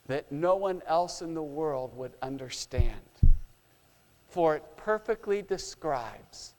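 hushed sermon narration. Acted. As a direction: draw them in with a quiet tender telling. Hushed storytelling of Jesus calling.

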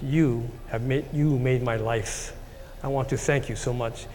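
slow, low, softened sincerity; quietly earnest, unhurried and low; heartfelt thanks. Retold or imitated. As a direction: retell the thanks gently, letting each phrase sit. Retold heartfelt thanks to mentor.

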